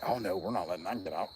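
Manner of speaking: low, soft mocking official voice; mock censor voice. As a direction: murmur it like a sneaky censor. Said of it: "YouTube, they might say".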